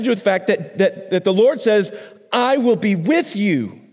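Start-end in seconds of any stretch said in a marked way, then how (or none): 2.3-3.8 tender promise. Acted. God's promise repeated.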